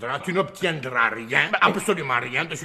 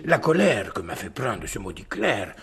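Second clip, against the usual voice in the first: low and soft, tired; husband worn out, speaking softly; weary low voice; 8 semitones lower, 5 dB quieter than usual. Acted. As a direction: sink into weariness. Weary baron heads to bed.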